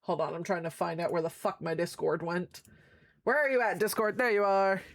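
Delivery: ordinary talk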